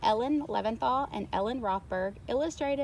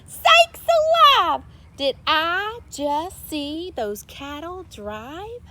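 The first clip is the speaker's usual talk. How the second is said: huge high shout, then a slower sheriff voice; 7 semitones higher, 9 dB louder, slower than usual. A narrator's reading. Sheriff shouting at the cows driving past. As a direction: yell it like a stunned sheriff. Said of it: "The sheriff shouted".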